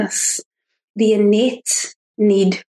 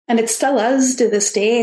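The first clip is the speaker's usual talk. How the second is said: usual speaking voice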